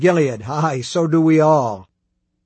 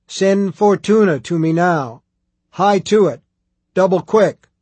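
bawled orders, loud and raised in pitch; full-volume shouting; 4 semitones higher, 7 dB louder than usual. A narrator's reading. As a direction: shout orders to the whole household like a megaphone. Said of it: "like listening to a woman shouting into an electric megaphone".

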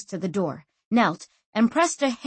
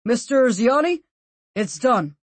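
muffled voice; 5 semitones higher, 4 dB louder than usual. A narrator's reading. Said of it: "said a muffled voice".

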